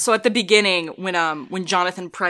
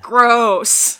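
loud, higher exclamation of disgust; mock disgust; 4 semitones higher, 7 dB louder than usual. Recalling the reaction to that line, loud disgust. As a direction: blurt it out, hammed-up and repelled. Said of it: "in the moment, I was like".

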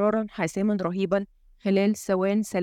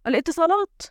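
higher-pitched cry of realisation; sudden exclamation; 8 semitones higher than usual. A narrator's reading. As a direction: exclaim as it suddenly dawns on you. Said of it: "وهو يهتف".

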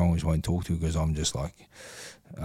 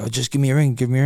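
louder, higher mock mate; mates urging; 6 semitones higher, 5 dB louder, faster than usual. Man mimics close mates urging him to call. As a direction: imitate a pushy mate, up and loud. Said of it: "they go".

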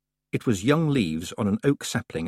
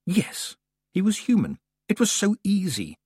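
delighted; 11 semitones higher than usual. A narrator's reading. Elemental delighting in being human.

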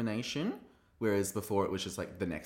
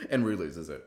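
ordinary delivery

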